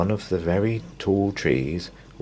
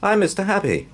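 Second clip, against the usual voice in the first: cheery greeting; 14 semitones higher than usual. Acted. Cheerful hero introduces himself.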